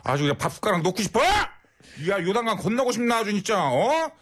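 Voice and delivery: high shouting rant at a rude driver; angry on request. Pretend. Scolding a rude male driver on request, furious shouting. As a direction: tear into him, yelling. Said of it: "화 좀 내주세요".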